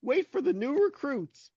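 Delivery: high, spooky ghost voice. Acted. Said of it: "I like your ghost".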